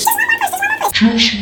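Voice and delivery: very high, loud, fast squeaky chant; mocking midwife. Mother mocking the midwife's nagging, very high and fast. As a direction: rattle it off in a shrill mocking squeak.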